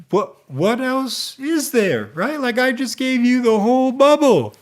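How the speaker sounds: high, incredulous mock protest; exasperated. Guy answers her asking what else, exasperated. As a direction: protest like a baffled, put-upon suitor.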